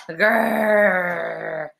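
long drawn-out growl; sudden monster "grrr"; troll growl. A narrator's reading.

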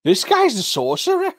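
squeaky high disbelief, laughing; high shocked voice. Retold or imitated. Mocking the stunned hunters in a high laughing voice. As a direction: gasp it out way up high through a laugh.

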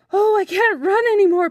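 high, loud wail of exhaustion; Birdling's desperate high-pitched plaint; exhausted cry. A narrator's reading. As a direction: cry out, worn out from running. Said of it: "panted Birdling at last".

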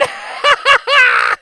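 high loud cackling laugh; gleeful cackle. A narrator's reading. Gingerbread boy laughing at chasers, high loud cackle. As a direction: cackle in triumph.

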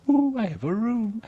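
high-pitched delighted squeal; giddy, voice leaping way up; excited. Pretend. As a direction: gush over a brand-new room. Delighted at getting a room.

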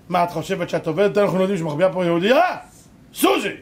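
loud interrogation rising to a yell; acted raid at the door; accusing Nazi shout. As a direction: confront her at full volume. Nazis at the door accuse her.

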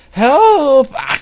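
very high, loud scream; acted high-pitched scream; scream for help. A narrator's reading. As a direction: shriek for help, then cut off. Acting the victim's cry for help.

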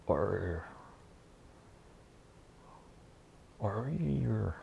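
drawn-out pretend bull roar; imitated bull bellow. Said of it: "Whatever the sound effects of a bull".

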